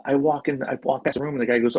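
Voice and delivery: usual speaking voice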